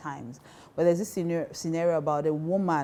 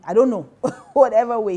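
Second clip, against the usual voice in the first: laughing aside; 6 semitones higher, 12 dB louder than usual. Real, not acted.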